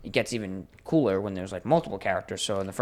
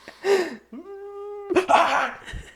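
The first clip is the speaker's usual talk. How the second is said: high laughing wail with a cry in it; witch wail. Retold or imitated. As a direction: wail and sob like the crying witch. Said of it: "a witch from Left Four Dead".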